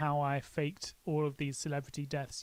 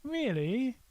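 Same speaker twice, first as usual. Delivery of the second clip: mock surprise; 6 semitones higher, much slower than usual. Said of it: "and then she goes".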